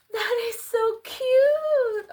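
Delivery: high, slow, drawn-out squeal; adoring high-pitched gush. Real, not acted. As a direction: coo it high, stretching the words. Gushing over the lit-up item.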